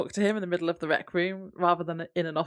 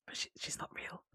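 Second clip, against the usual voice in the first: hushed, voiceless whisper; very soft confidential whisper; whispered aside; 18 dB quieter than usual. Real, not acted. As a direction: whisper it like a secret spoiler. Whispered aside spoiling a plot twist.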